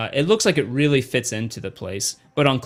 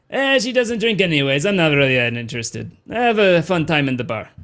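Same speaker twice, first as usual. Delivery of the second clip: put-on higher, dismissive Weequay doorman accent; 4 semitones higher than usual. Doorman calling after departing guests, Weequay accent. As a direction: brush them off in a Weequay accent. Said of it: "he says".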